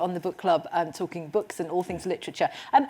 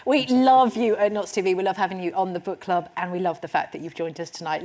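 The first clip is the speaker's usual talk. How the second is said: usual speaking voice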